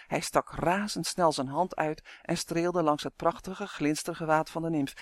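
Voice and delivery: plain reading voice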